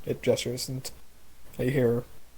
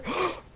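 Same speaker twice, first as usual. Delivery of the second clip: sharp high frightened gasp; scared gasp. Acted. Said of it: "very scared and shrill voice".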